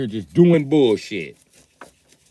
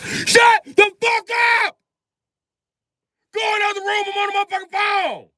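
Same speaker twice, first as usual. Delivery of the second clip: shouting at the noisy room from the phone; furious yell. Acted. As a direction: scream at the room to shut up. Yelling at people in background.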